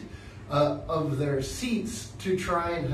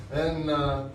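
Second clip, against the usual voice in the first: ordinary talk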